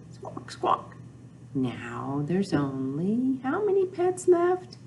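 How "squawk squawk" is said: breathy squawk squawk bird call; soft bird squawk; whispered squawk. Pretend. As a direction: squawk softly like a parrot. Bird noise in story.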